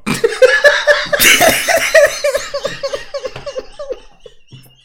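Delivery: long, loud burst of laughter; high, helpless laugh that tails off; real laughter. Real, not acted. Interviewer laughs at band's silly talk.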